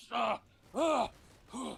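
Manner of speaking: high shouted caveman grunts; hammed-up primitive cries. Caveman noises.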